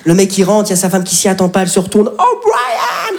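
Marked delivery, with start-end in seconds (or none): 2.2-3.2 high, shrieking cry of joy; joyful scream. Acted. Wife greeting her returning soldier husband, high joyful shriek. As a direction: shriek his name in tearful delight.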